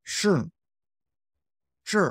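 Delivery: short falling SHI; falling fourth tone. Shown as a demonstration. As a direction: say SHI high and let it fall fast. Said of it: "falling, fourth tone".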